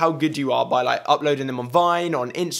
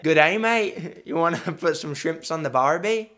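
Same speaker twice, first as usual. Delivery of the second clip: put-on Australian accent. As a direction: do a hammy Australian greeting.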